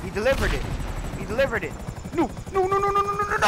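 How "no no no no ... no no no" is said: high, rapid string of no's; desperate no's. Real, not acted. Gamer watching the target escape, desperate high no's. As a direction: plead as the mission slips away.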